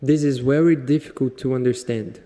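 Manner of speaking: loud learner try at an Indian accent; practising Indian English, loud; imitating Indian accent. Shown as a demonstration. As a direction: repeat the line in an Indian accent, very as wery. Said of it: "Quero ouvir".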